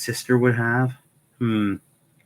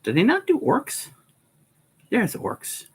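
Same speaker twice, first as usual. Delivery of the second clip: squeaky, much higher disbelieving question; incredulous; 13 semitones higher than usual. Real, not acted. Fan finds no orcs in the list: high incredulous squeak. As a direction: ask it in outraged disbelief, pitch jumping way up.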